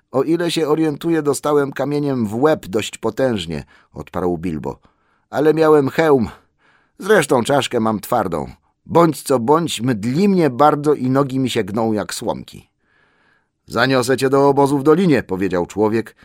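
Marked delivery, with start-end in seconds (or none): 0.1-3.6 high hobbit voice; Bilbo, dazed but talkative. Acted. Injured hobbit reports his knock to the rescuer, high-voiced. 5.3-12.7 high, loud hobbit voice; Bilbo, dazed but talkative. Acted. Injured hobbit reassures then complains to rescuer, high-voiced. 13.7-15.2 loud, high and fast; brisk offer. A narrator's reading. Man offers to carry the hobbit, brisk and loud.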